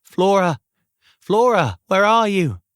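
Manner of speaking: loud, high calling out; yelling. Acted. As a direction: shout her name from afar. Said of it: "Jacob's muffled voice could be heard yelling".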